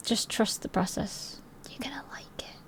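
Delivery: soft conspiratorial whisper. Real, not acted. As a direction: lean in and whisper the promise. Reassuring friend about milkshake place.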